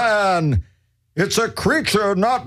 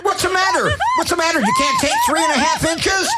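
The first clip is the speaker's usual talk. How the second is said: high indignant squeal; 12 semitones higher, faster than usual. Acted. High offended squeal at laughing woman.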